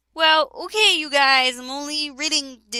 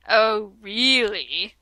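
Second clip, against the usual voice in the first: whimpering; 3 semitones lower, much slower than usual. A narrator's reading. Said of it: "he whimpered".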